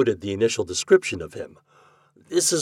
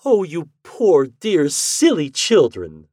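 slow, high, loud tender cry; 7 semitones higher, 7 dB louder, slower than usual. A narrator's reading. Wife comforting the sobbing young bride, slow tender cry. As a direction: gush over them with pity, slowly. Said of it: "my wife cried".

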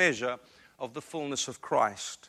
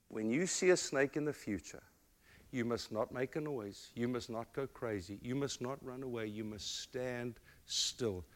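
a softer, lower voice, mother kneeling to her son; mother holding her son's face, speaking gently; soft, earnest mother; 5 semitones lower, 13 dB quieter than usual. Retold or imitated. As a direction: speak gently to a small child. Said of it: "she said".

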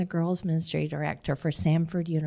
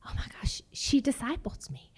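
whispered oh my gosh; a soft stunned whisper; hushed amazement; 4 semitones higher, 9 dB quieter than usual. Retold or imitated. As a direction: breathe it out in awe. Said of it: "And she went".

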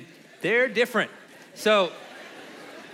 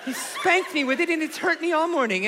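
high-pitched mom explaining herself; indignant mom voice; 8 semitones higher than usual. Retold or imitated. Mom explaining the curling iron mishap, high protesting voice. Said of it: "and she said".

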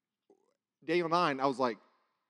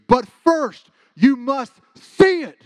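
loud, higher, slow "you must see it"; booming command; 11 semitones higher, 15 dB louder, much slower than usual. Shown as a demonstration. Dream preacher calling after the speaker, slow and booming. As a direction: thunder it out slowly, like a voice that shakes windows. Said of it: "Like that".

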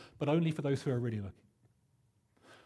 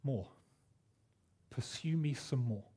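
gentle divine nudge; 3 semitones lower, 6 dB quieter than usual. Retold or imitated. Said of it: "we felt God nudge us".

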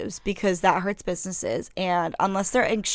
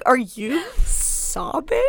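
slow, raised incredulous question; imitated customer; 4 semitones higher, much slower than usual. Customer questioning a crying clerk, mock incredulous. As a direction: stretch it out in mock disbelief. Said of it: "She's like".

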